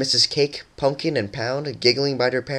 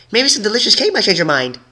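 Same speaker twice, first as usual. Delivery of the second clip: high, louder sweet coaxing; bribing; 11 semitones higher, 5 dB louder than usual. A narrator's reading. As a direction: tempt her sweetly. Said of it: "resorted to bribery".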